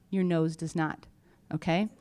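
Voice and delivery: ordinary talk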